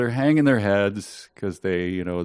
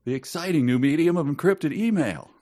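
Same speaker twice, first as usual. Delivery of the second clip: infomercial voice; 8 semitones higher than usual. Pretend.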